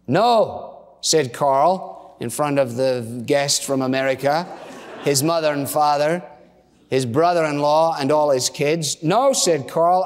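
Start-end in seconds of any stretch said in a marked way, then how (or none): 0.0-0.8 higher, emphatic falling no; mock bitter outburst; bitter refusal. Husband answering wife before guests, bitter and emphatic. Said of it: "sense of bitterness which accompanied it". 9.0-9.3 high, emphatic mock no; bitter refusal. Husband refusing before all the family, bitter and emphatic. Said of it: "sense of bitterness which accompanied it".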